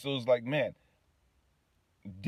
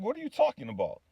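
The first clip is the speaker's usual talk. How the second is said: higher-pitched, incredulous comeback; baffled retort; 10 semitones higher, faster than usual. Retold or imitated. Man answering God's sudden question, baffled and incredulous. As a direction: throw the question back in disbelief. Retelling his baffled reply to God.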